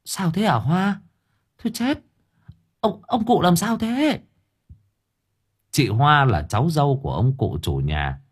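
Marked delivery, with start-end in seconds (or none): 0.1-4.2 put-on high anxious old woman's voice; alarmed neighbor. A narrator's reading. Neighbor woman rushes over asking Hoa, alarmed. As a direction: ask in alarm what happened.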